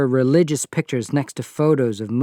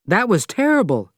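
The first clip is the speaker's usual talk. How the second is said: angry; 8 semitones higher than usual. Acted. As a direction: scold her, upset. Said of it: "I said angrily".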